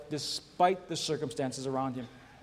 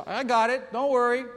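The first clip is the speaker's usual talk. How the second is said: a touch of a wife calling back, higher and louder; wife's reply; 7 semitones higher, 5 dB louder than usual. Retold or imitated. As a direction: lightly brush him off. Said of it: "She said".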